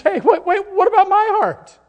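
put-on high, loud childish plea; child's cry. A child calling to a straying parent, high and loud. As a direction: call out like a hurt child. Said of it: "a child who says".

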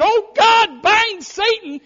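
high, loud pleading cry; mock prayer shout. Preacher mimics church praying loudly to God, high shout. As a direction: cry out to God to bind Satan. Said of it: "we're saying".

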